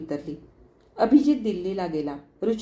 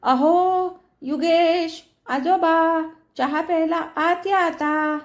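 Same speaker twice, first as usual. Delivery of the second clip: louder, higher call, slowing at the end; calling from kitchen; 7 semitones higher, 4 dB louder, much slower than usual. A narrator's reading. Yuga calls the boy in for tea from the kitchen, loud playful call. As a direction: call through the house, holding back a laugh. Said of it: "ओरडून म्हणे".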